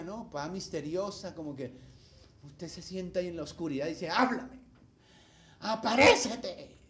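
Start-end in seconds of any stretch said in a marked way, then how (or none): 4.1-6.8 loud, drawn-out, higher summons; mock summoning into the dark; mock genie summoning. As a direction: call out as if conjuring a genie, hammed-up. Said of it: "así como si fuera Aladino el Señor".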